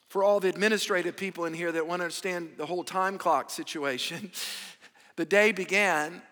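ordinary delivery

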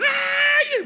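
startled high yelp; sharp surprised cry. Retold or imitated. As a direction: let out a sudden high yelp. Retold exclamation.

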